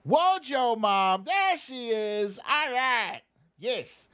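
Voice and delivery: ordinary delivery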